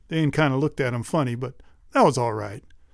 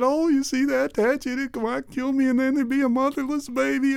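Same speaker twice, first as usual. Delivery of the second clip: put-on higher, teary girlish voice; a weepy daughter's higher retelling; whiny tearful girl; 9 semitones higher than usual. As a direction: plead through tears like a child. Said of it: "all three of 'em started crying".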